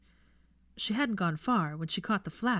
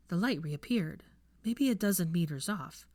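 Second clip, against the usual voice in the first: ordinary delivery, nothing marked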